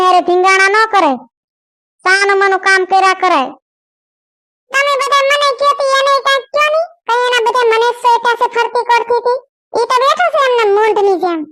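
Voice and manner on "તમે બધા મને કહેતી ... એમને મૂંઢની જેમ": put-on higher young wife's voice, apologetic; young woman pleading. Kayadi begging her in-laws to forgive her, pleading. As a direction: plead for forgiveness like a scolded bride.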